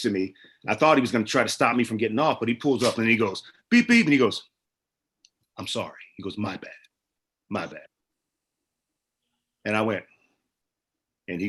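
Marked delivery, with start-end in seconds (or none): 3.7-4.0 high, loud "beep, beep"; two punchy mimicked honks; imitated car horn. 5.6-7.9 subdued apology. Retold or imitated. Driver's subdued apology, retold.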